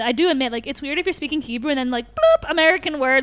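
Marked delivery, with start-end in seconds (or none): 2.2-2.5 sudden high, louder "bloop" noise; imitated bloop. Shown as a demonstration. Showing an English word dropping into Hebrew, high bloop.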